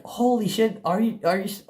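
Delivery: high-pitched surprised gasp of a mom. Retold or imitated. Mom walking in on son journaling, surprised high outburst. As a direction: imitate mom's amazed outburst. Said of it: "she'd be very surprised".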